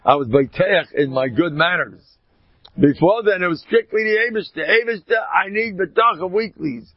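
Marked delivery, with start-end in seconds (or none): 2.8-6.9 high, loud preaching voice; a raised, urgent sermon call; fervent preaching. Acted. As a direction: rouse them to pray before every meeting. Preaching at full force.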